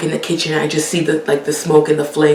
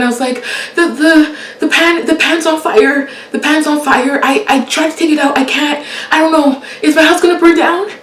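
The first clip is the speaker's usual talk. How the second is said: high, loud frantic pleading; a panicked woman begging the dispatcher; panicked caller; 10 semitones higher, 4 dB louder than usual. Retold or imitated. Caller to 911 about the pan fire, panicked and high.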